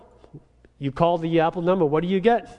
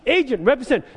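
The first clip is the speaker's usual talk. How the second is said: firm, loud words for the machine; calling for an agent, loud and firm; 7 semitones higher, 3 dB louder than usual. Retold or imitated. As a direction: say it loud so she hears. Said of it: "I say that really loudly".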